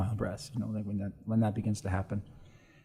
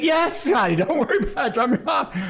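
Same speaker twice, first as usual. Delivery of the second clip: much higher, laughing buddy voice; laughing imitation; 6 dB louder than usual. Priest voicing buddy saying drop me off, laughing. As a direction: laugh out the friend's reassurance.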